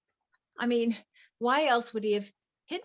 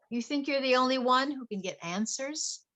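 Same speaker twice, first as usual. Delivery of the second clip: ordinary delivery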